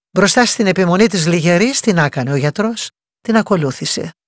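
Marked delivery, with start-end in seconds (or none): none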